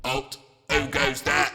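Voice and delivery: slow, challenging night guard; security guard voice. Acted. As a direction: halt them like a guard on duty.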